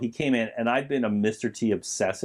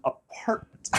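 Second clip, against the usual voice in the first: "Apartment." breathed, higher, amused; savoring apartment; 9 semitones higher, 5 dB quieter, slower than usual. Retold or imitated. Mocking the word he found funny, whispered. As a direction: linger on "Apartment." with relish. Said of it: "so funny that he says apartment".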